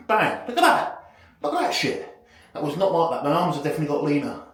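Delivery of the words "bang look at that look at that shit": excited. Real, not acted. Shows off his physique, excited.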